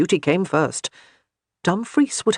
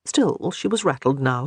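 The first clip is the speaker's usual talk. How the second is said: ordinary delivery, nothing marked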